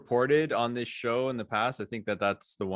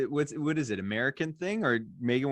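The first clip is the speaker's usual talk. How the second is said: ordinary delivery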